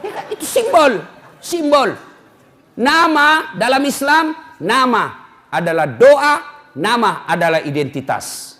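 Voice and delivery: ordinary talk, nothing marked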